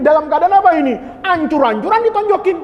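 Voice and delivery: ordinary delivery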